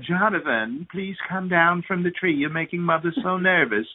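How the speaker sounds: lightly voiced, fussy mother begging her son; mock pleading mother. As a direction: coax him down like an anxious, overly polite mom. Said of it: "his mother was going".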